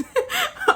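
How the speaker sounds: real high-pitched burst of laughter; breaking up laughing. Real, not acted. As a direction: squeal of laughter at yourself. Laughs at own slip.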